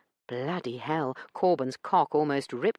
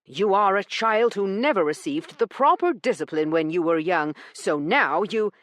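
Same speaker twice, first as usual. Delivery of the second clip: louder, talking over her; raised voice; 4 semitones higher, 4 dB louder than usual. A narrator's reading. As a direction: raise your voice over hers. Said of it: "Corbin raised his voice to be heard over hers".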